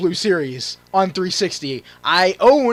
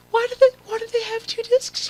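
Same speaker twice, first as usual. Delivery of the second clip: incredulous squeak. Real, not acted. Mock incredulous question.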